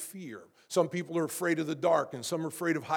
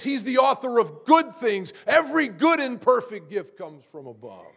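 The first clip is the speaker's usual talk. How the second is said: higher-pitched, emphatic pulpit proclamation; impassioned preaching; 4 semitones higher, 4 dB louder than usual. Real, not acted. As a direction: preach it with mounting conviction. Preacher rising to full force.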